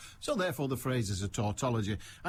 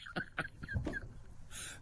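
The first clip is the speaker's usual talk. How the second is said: breathy laugh; 10 dB quieter than usual. Real, not acted. Host laughs at own anecdote.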